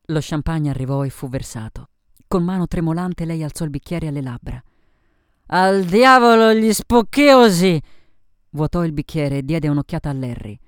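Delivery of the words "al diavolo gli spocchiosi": put-on loud, high drunken shout; slow, rowdy cry of defiance; defiant toast. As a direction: bellow a boozy toast, drawn out.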